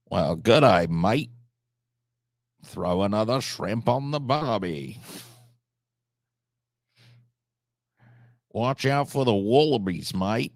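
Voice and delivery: hammed-up Aussie greeting, broad vowels; tourist-cliché Australian twang; mock Australian accent. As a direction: put on a cartoon Australian accent. Mock Aussie catchphrases in a put-on accent.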